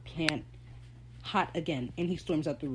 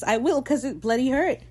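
loud, high, aggrieved retort; imitated indignation; 6 semitones higher, 6 dB louder than usual. Fan imitates Reese after the headbutt, indignant. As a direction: raise pitch and volume in mock complaint. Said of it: "the way Reese says".

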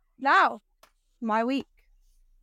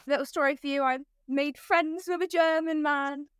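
ordinary talk

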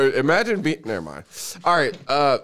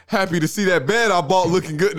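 laughing quote; 6 semitones higher, 5 dB louder than usual. Retold or imitated. Quoting [name]'s comeback to friends, laughing. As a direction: crack up while quoting him.

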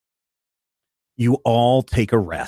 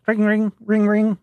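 high sung ring ring; phone ringing noise; 11 semitones higher than usual. Acted. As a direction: chime it like a phone.

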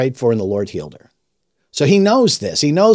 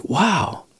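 soft, raised-pitch amazement; retold quiet awe; 7 semitones higher, 5 dB quieter than usual. Dad hears his son's tribute, quietly amazed. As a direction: let it out softly, as if taken aback. Father moved by son's words.

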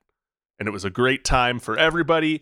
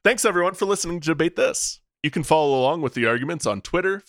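usual speaking voice

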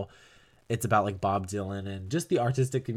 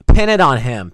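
laughing loud outburst; 4 semitones higher, 15 dB louder than usual. Real, not acted. Vlogger blurting her Dylan theory, laughing loud. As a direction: burst out laughing. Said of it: "laughing outburst".

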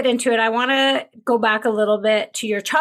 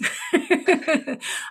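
high laugh; 8 semitones higher than usual. Real, not acted. Laughs at brother-in-law's nickname.

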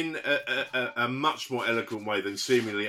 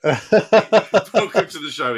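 showman welcome; 4 semitones higher, 11 dB louder, much slower than usual. Acted. Announcer style welcome.

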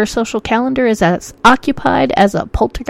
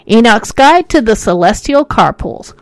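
normal talking voice

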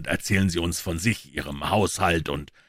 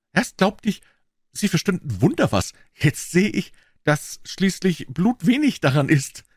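higher-pitched eager pawnbroker; Wilson's voice; 13 semitones higher, 3 dB louder than usual. A narrator's reading. Wilson, the pawnbroker, answering Holmes, in a higher voice. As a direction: pitch him up as the earnest tradesman.

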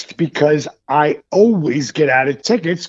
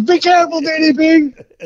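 imitated starstruck cop; 10 semitones higher than usual. Cop waving wrestler off, imitated excited fondness. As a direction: call it out like a smitten fan. Starstruck cop's farewell, acted.

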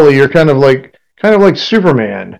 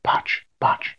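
hushed whispered jinx; whispering; 11 dB quieter than usual. Real, not acted. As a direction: whisper it under your breath. GM hoping the roll fails.

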